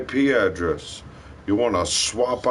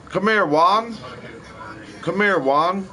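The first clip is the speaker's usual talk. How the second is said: calling out; 8 semitones higher than usual. Shown as a demonstration. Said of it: "saying names for example".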